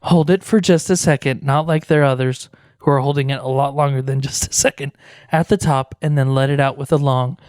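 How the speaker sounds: guided meditation. Acted. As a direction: ease them into stillness.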